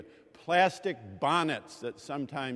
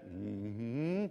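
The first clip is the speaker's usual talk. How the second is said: ordinary talk, nothing marked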